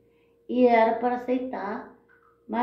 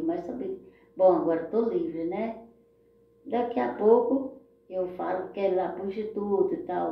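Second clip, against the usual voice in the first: ordinary talk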